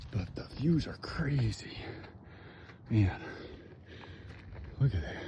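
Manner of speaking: breathy hushed whisper, slowed; awed whisper. Real, not acted. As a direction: whisper it in awe at the view. Awed at the mountain view.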